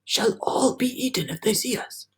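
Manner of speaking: high, breathless frightened whisper; terrified earthworm whispering; whispered in fear. Acted. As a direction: whisper as if they might hear you. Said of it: "the earthworm whispered".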